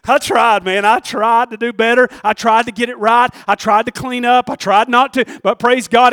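loud, insistent revival preaching; forceful preaching. Real, not acted. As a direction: preach it loud, phrase piled on phrase. Preaches on trying to change.

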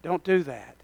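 hushed inner warning; still small voice. Pretend. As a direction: say it like a quiet inner voice. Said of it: "this still small voice goes".

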